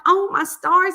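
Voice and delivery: high, loud exclamation of wonder; acted amazement. Retelling the kitten running for chicken, amazed. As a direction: burst out, marvelling.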